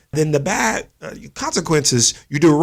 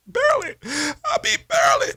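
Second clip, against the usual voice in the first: mock whimper. Grudging fan admission, voice cracking.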